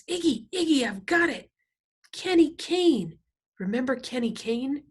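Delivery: high, loud eureka cry; excited recognition. A narrator's reading. Loretta jumping up to tell Iggy, high and excited. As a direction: burst out with the name, pitched high.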